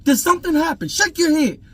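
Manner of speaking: put-on high-pitched, heated demand to a child; furious questioning. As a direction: retell an angry dad urgently pressing his son for answers. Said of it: "I was furious".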